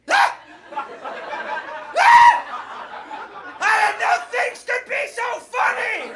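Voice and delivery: shrieking with laughter, very high; scream-laugh like giddy sixth graders; laughing kids. Retold or imitated. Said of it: "We were like".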